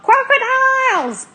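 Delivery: high, loud, stretched shout; yelling in alarm; yelled alarm. A narrator's reading. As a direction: cry out the danger at full voice. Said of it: "yelled Zigomar".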